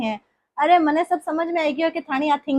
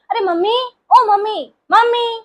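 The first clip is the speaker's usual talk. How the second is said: high, loud call for mom; calling mom loudly; 6 semitones higher, 3 dB louder than usual. Acted. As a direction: yell for mom across the house. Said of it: "टीना क्यों चिल्ला रही है". (बेटी का माँ को ज़ोर से ऊँची आवाज़ में पुकारना)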